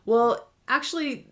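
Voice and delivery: usual speaking voice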